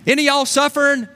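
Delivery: high, loud preacher's call; impassioned exhorting voice, high and punchy; James calling out. Acted. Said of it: "James would say".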